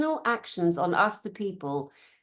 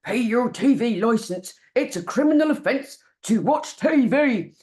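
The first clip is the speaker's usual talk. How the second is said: mock official tone, higher and louder; stern official letter voice; 4 semitones higher, 6 dB louder than usual. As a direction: read it like a pompous authority warning. Reading out the threatening letter.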